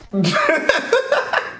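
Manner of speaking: high-pitched burst of laughter. Real, not acted. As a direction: break into a squealing laugh. Laughing at the reveal.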